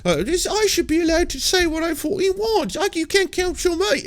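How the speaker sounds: high, whiny mock complaint; mock a comic whining about cancel culture; high mocking impression of a comedian. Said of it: "they're like".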